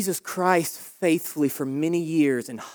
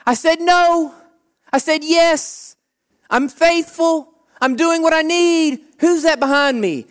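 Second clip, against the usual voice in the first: higher, louder, slowed protest that turns to alarm; anxious faithful believer; 8 semitones higher, 3 dB louder than usual. Acted. Believer looking back at the chariots, raised and uneasy. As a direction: insist you did right, then spot the threat. Faithful person notices pursuers.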